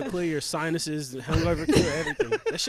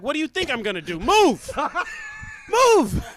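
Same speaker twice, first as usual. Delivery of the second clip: yelling Move much louder and higher; acted yell; 13 semitones higher, 10 dB louder than usual. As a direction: bark the order to get out of the way. Dog owner yelling at neighbor.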